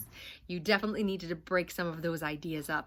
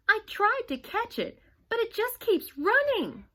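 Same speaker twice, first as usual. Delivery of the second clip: panicked sister; 14 semitones higher, 5 dB louder than usual. Acted. Darcy still panicked.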